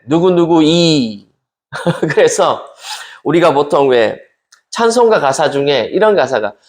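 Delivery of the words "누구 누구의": put-on Gyeongsang accent, "누구이" with 의 flattened to 이, slowly; Gyeongsang pronunciation. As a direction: imitate Gyeongsang speakers saying "누구이" for 누구의. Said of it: "이렇게 얘기해야 되는데". (경상도 사투리로 "누구이")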